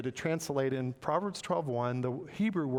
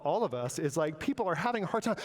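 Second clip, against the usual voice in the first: ordinary talk, nothing marked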